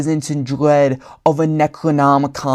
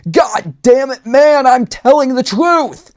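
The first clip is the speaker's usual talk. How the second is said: much higher, louder desperate bellow; 9 semitones higher, 4 dB louder than usual. A narrator's reading. Agent insists to the clowns, bellowing desperately. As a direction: shout it like nobody believes you. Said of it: "the D G A S agent bellowed".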